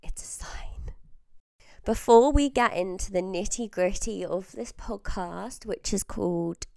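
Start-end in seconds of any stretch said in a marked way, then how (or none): 0.0-0.9 hushed, spooky aside; eerie whispered "it's a sign"; mock-ominous whisper. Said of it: "For the last time".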